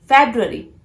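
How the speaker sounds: "February" as FEB-roo-uh-ree; "February" with B and R sounded; correct February. Shown as a demonstration. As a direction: land firmly on FEB in "February". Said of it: "pronounce this word as".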